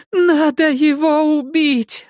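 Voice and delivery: screams it high through tears; shrieking. A narrator's reading. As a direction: shriek in hysterical terror. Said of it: "вопит мать, заливаясь слезами".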